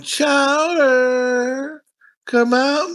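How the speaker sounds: high sing-song call to lure him out; calling out creepily. Retold or imitated. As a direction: neighbor luring the kid out of the house, sing-song call. Neighbor calling out with bottles.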